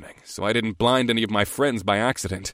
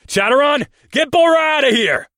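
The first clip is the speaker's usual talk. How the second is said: high, loud urgent shout; shouting over shoulder; 8 dB louder than usual. A narrator's reading. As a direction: yell it over your shoulder. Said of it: "I shouted over my shoulder".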